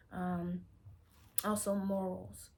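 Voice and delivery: usual speaking voice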